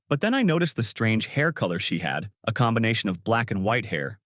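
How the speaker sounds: ordinary delivery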